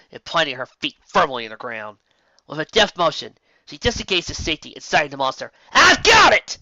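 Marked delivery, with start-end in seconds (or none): 5.7-6.6 high, loud excited shout. A narrator's reading. Megan to the group, loud high shout. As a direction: call it out, sighting the monster. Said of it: "she shouted".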